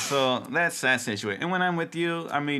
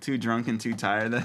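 normal talking voice

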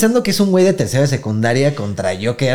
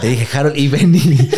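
laughing quote; 3 semitones higher, 3 dB louder than usual. Retold or imitated.

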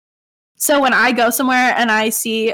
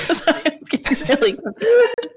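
apologizing through real laughter, higher; laughing apology; 6 semitones higher than usual. Real, not acted. To her co-host, laughing at her own mix-up. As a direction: laugh your way through the words.

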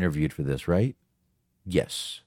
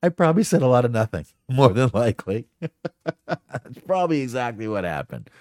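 laughing through the words, higher and quicker at first; amused self-mockery; 5 semitones higher than usual. Real, not acted. Host to listeners after rambling for ages, laughing at himself. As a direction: crack up at your own rambling.

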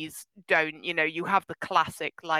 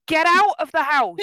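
raised, fed-up plea at the screen; exasperated yell; 8 semitones higher, 6 dB louder than usual. Retold or imitated. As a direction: tell them off, exasperated. Yelling at horror movie characters.

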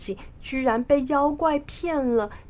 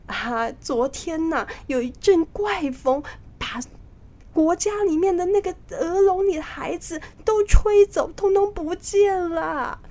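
alarmed official; 9 semitones higher than usual. Acted. Official telling the king the children were blown away, alarmed. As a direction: voice climbing high as the report goes on.

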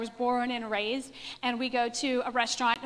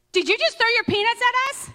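higher, louder, an indignant demand; angry accusation; 10 semitones higher, 5 dB louder than usual. Retold or imitated. Angry man accusing teenage girls at a restaurant, loud and indignant. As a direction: snap the question like an offended stranger.

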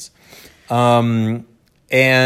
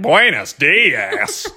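put-on slow, drawn-out gringo accent in Spanish; exaggerated, clumsy American accent, making fun; mocking American-accented Spanish; much slower than usual. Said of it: "he'd walk back ... and make fun of me".

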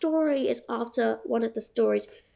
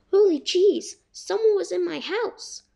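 excited; 6 semitones higher than usual. Acted. Narrator mouse discovers intruder.

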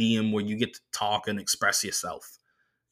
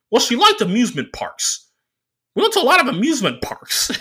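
much higher, louder nerdy driver voice; the driver's voice; 13 semitones higher, 5 dB louder than usual. Retold or imitated. Comedian doing the driver's voice for the crowd, high and squeaky.

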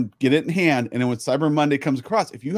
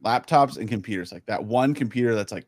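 usual speaking voice

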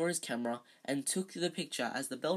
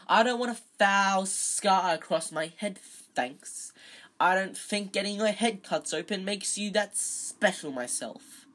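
scornful sneer; 5 semitones higher, 6 dB louder than usual. Shown as a demonstration. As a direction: deliver the sneer cleanly. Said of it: "I completely botched that".

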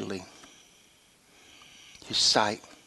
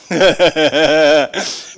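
long staccato mock cackle, louder; gloating laugh; 5 dB louder than usual. Father hears of son's parenting trouble, gloating laugh. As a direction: cackle at his comeuppance.

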